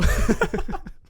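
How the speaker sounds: squealing laugh; high laugh. Real, not acted. Laughing at own silly greeting. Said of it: "That's super annoying".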